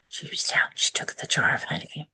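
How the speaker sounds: whispered, barely voiced narration; hushed whisper. A narrator's reading. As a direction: drop to a breathy whisper as she takes the jar. Quiet moment, taking the honey.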